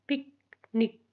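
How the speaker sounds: Picnic as short पिक, long pause, short निक; two short beats in picnic, far apart; split into syllables. Shown as a demonstration. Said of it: "ये divide किससे हो रहे हैं".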